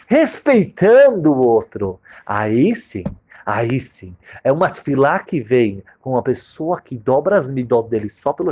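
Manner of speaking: pregação alta e intensa; full-force preaching. Acted. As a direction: martela a lição para a congregação. Prega com força ao público. (pregação inflamada)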